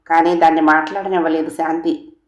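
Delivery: usual speaking voice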